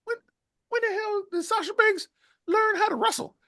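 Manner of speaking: very high incredulous squeal; mock astonished fans. Host voicing stunned fans asking when Sasha learned to wrestle, high and incredulous. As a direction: squeal it in disbelief.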